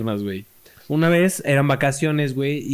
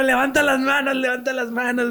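excited call; 8 semitones higher than usual. Retold or imitated. Cheering on ride.

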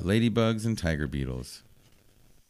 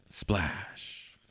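soft whispered sound word, much softer; whispered splash; 7 dB quieter than usual. Acted. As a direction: breathe out the splash softly. Junior falls into the water.

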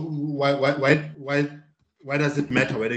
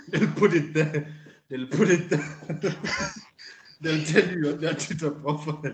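laughing through the words, pitched up; 4 semitones higher, 3 dB quieter than usual. Real, not acted. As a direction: crack up at your own joke. Joking reply, laughs through it.

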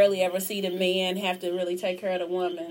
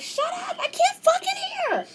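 put-on cussing old husband. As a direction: snap at your wife like a fed-up old man. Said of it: "they be going off like".

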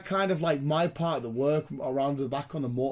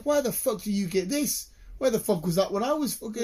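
outraged rant; 7 semitones higher than usual. Retold or imitated. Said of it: "And I'm like".

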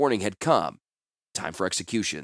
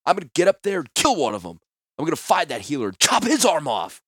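fast, loud, higher vengeful rant; angry; 6 semitones higher, 4 dB louder than usual. Acted. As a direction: rant with murderous rage. Klars threatens revenge.

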